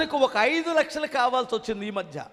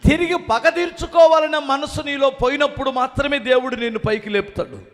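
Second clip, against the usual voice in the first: ordinary talk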